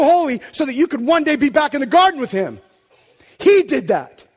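loud, high, full-force sermon; impassioned preaching. Real, not acted. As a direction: preach it with rising fervour.

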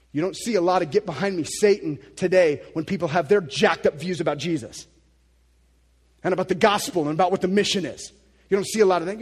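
loud, driving preacher voice. Acted. Preacher scolding modern views of Jesus, loud and driving. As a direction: press the point home hard.

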